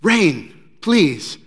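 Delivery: loud desperate two-word beg; loud plea. Retold or imitated. Worshippers pleading for rain, loudly.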